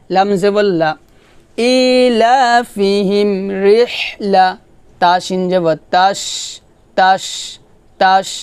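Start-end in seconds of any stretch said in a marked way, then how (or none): 1.6-4.5 slow recitation. Shown as a demonstration. Recites the phrase.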